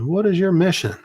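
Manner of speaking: a sergeant testing a private, demanding question; stern sergeant. Retold or imitated. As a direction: quiz the private like his sergeant would. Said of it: "he said".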